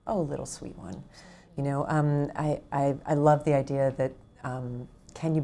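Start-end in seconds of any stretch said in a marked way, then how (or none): 0.0-1.0 put-on soft, low cooing to a scared child; tender soothing tone. Shown as a demonstration. Speaking gently to her own fear, soft and tender. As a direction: soothe your own fear like a small pet. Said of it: "that's the tone I use when I speak to my fear".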